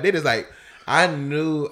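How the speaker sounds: ordinary delivery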